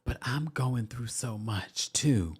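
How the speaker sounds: soft, slow mock whisper; hushed and drawn-out, imitating her; retold whisper. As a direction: slowly murmur it like a put-on confidence. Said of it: "she whispers and she says".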